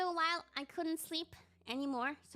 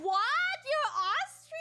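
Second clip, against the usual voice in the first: much higher, louder, stunned squeal; shocked disbelief; 13 semitones higher, 8 dB louder, slower than usual. Retold or imitated. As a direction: blurt it out in total shock. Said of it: "And I'm like".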